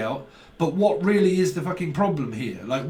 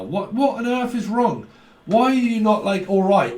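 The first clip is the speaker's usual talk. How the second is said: exasperated outburst; 7 semitones higher, 4 dB louder than usual. Retold or imitated. Exasperated at unresponsive people.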